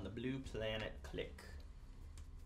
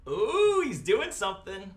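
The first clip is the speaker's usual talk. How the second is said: excited; 6 semitones higher, 9 dB louder, slower than usual. Real, not acted. Opponent drops a surprise move.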